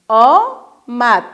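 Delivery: کشیده و آهسته; drawn out slowly. Shown as a demonstration. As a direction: stretch the word very slowly. Teacher modelling syllables.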